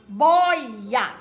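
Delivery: "Boia" with "boi" loud and long; stressed syllable. Shown as a demonstration. Teacher showing a paroxytone, "Boia" loud and drawn out on "boi". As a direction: boom out the first syllable of "Boia". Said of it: "Boi é paroxítona".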